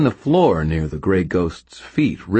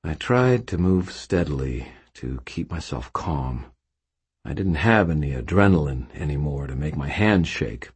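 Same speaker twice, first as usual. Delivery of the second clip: ordinary delivery, nothing marked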